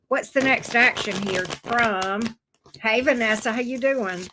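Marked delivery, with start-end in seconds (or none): none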